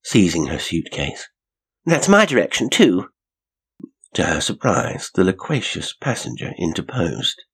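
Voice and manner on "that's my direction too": high, loud and eager; eager exclamation. A narrator's reading. Janet hurrying after the man, exclaiming high and loud. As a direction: call it after someone leaving, pitched up.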